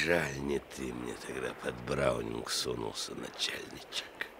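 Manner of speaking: sinking to a hissed whisper; bitter whisper. Acted. Captured Korniukha to the officer, bitter whisper. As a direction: spite breathed at the end.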